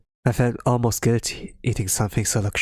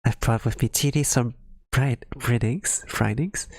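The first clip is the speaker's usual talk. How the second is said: ordinary talk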